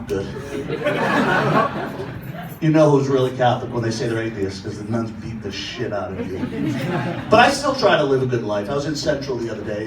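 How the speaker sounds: ordinary talk, nothing marked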